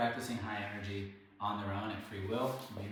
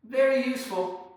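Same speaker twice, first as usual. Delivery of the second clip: very useful; 12 semitones higher, 11 dB louder than usual. Real, not acted.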